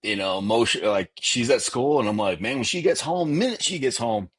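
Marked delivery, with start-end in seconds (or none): 2.4-4.3 higher-pitched, worked-up retold rant; fired-up parent. Parent waiting for daughter to get home from school, fired up. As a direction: retell being riled up, pitch lifted. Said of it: "fired up".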